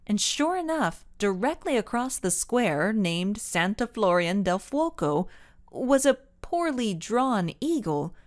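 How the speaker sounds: usual speaking voice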